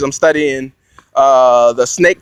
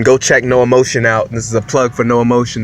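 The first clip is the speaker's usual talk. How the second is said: ordinary talk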